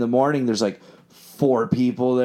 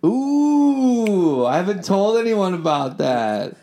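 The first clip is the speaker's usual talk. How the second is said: long high "ooh", then higher-pitched reply; playfully caught out; 7 semitones higher, 3 dB louder, slower than usual. Real, not acted. Musician outed by host about stand-up, playfully caught out. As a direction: react as if a secret just slipped out.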